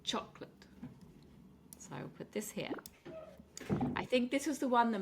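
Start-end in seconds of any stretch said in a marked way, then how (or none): 0.0-0.7 "chocolate" as a soft CHOC-lit, two syllables; first-syllable stress. Shown as a demonstration. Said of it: "stress is on the first syllable".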